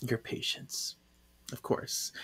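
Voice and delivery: hushed, breathless whisper; barely voiced, choked up; a retold tearful whisper. Piper breaks down, whispered.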